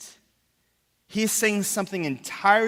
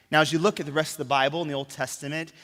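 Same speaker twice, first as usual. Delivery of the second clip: ordinary delivery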